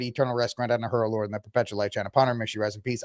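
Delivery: quick mock-solemn prayer recital; mock prayer, fast; rattled-off prayer. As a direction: rush through the prayer words.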